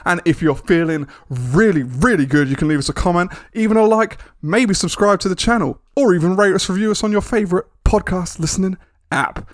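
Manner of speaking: lively lifted promo voice; podcast promo read. Acted. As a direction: sell it like an excitable announcer. Reads the podcast's promo break.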